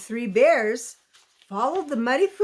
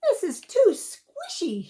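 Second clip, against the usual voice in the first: lifted voice, mildly displeased; girlish complaint voice; higher mock complaint; 8 semitones higher than usual. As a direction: fuss about the squishy bed. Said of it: "she said".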